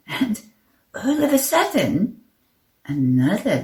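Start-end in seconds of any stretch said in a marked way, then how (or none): none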